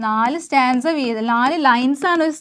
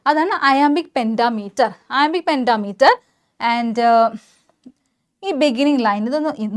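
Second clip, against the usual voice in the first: usual speaking voice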